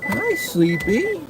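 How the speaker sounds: very high, slow coo to a sleepy cat. Real, not acted. Greeting cat in pet voice.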